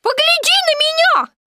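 very high squealing protest; shouting. A narrator's reading. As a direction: demand attention in a piglet's shriek. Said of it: "закричал Пятачок". (пронзительный писклявый крик)

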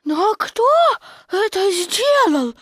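put-on high, slow child-character voice; drawn-out, high little kangaroo question; little Roo. A narrator's reading. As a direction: ask in a small, high-pitched voice. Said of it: "спросил Ру".

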